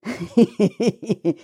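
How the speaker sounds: sly, nasty snicker; nasty giggle. Acted. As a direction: giggle unpleasantly. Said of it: "нехорошо захихикал".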